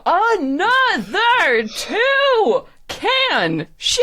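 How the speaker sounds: high, loud, drawn-out announcer call; hyped contest announcer. Acted. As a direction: whip up the crowd like a game-show host.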